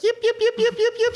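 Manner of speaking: very high tiny-dog yips; squeaky little dog barking through glass; yappy little dog. Retold or imitated. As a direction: yap like a small dog at a window. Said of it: "this little dog ... going, like".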